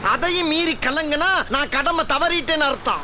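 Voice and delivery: high-pitched weepy insistence; tearful denial. Acted. Constable denying to colleague that he ever cries, tearful. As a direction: wail it proudly through tears. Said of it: "ஏன் ஏட்டையா அழுகுறீங்க".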